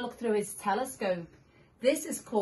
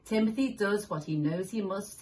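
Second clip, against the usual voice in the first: usual speaking voice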